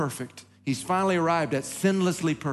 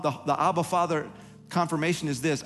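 usual speaking voice

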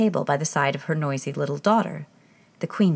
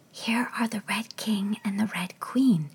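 softer, hushed whisper; 4 semitones higher, 4 dB quieter than usual. Acted. Alice to herself near the chessmen, whispering. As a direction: whisper so as not to frighten them. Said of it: "Alice said in a whisper".

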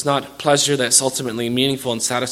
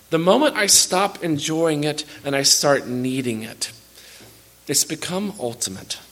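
ordinary talk

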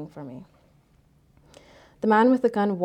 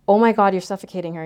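quick, louder burst of alarm; alarmed plea; 8 dB louder, faster than usual. A narrator's reading. Mother to the man smothering her child, alarmed. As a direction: blurt it out in urgent protest.

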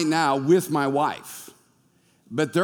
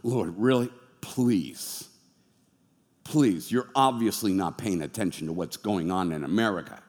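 low, soft, weary protest; exasperated Gideon; 8 semitones lower, 4 dB quieter than usual. Acted. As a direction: sigh it out as a fed-up Gideon. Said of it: "God's like".

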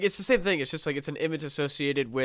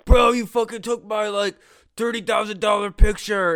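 higher, louder indignant bro shout; outraged NFT bro; 6 semitones higher, 8 dB louder than usual. Retold or imitated. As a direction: yell it like a cheated crypto bro. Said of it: "these N F T bros are like".

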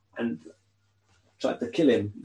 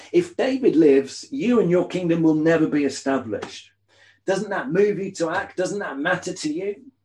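higher and louder, urgent demands; angry pleading; 6 semitones higher, 3 dB louder than usual. Acted. Saul urging his son Jonathan to act, higher urgent anger. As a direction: push him, demanding he act.

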